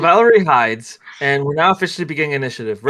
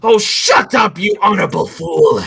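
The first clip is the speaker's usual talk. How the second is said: irritated villain; 5 semitones higher, 5 dB louder than usual. Acted. Villain silences his screaming captive.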